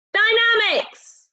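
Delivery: high stretched Dynamics; high slow dynamics. Shown as a demonstration. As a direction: lift Dynamics up high slowly. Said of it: "Can you say dynamics?".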